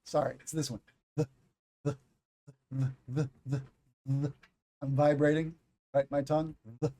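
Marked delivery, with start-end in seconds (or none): 1.2-4.3 buzzing voiced the. Shown as a demonstration. Teacher demonstrating the voiced TH sound. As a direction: vibrate the tongue on the. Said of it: "you have the voice sound".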